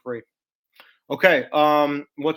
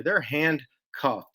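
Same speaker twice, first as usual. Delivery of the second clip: usual speaking voice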